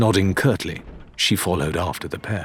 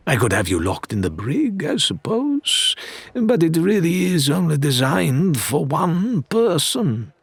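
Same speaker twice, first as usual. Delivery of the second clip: Faisal's smooth voice; 8 semitones higher than usual. A narrator's reading. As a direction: savour each word like a gracious threat. Faisal's voice continues.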